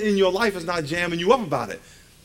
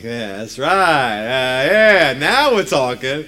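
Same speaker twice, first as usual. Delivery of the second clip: drawn-out approval, building louder; gleeful coach cheer; 4 semitones lower, 7 dB louder, much slower than usual. Retold or imitated. Father to exhausted son in workout, gleeful drawn-out cheer. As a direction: egg him on with slow, swelling relish.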